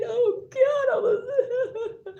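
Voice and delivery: high, helpless laughter breaking through the words; laughing hard. Real, not acted. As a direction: crack up mid-sentence. Laughing fit mid-rant.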